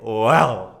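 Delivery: big loud retold "wow"; amazed. Hearing she is a property investor, amazed. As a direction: burst out impressed.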